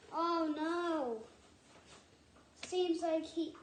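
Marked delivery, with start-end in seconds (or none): none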